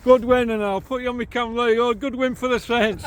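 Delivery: loud, high, fast cheerful call with a laugh. Real, not acted. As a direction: call out the football result with a chuckle. Calling to stranger, laughing.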